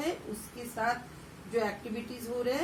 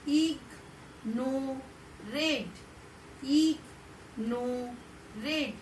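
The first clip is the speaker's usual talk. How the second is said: slow drill; 3 dB quieter, much slower than usual. Shown as a demonstration. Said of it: "repeat करिए".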